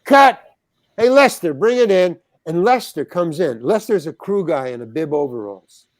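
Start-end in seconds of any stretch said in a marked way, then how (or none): 0.0-2.2 loud, high call across the set; director calling cut. Retold or imitated. Director on set shouts for Lester, loud and high. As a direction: shout it out to the crew, high and strong.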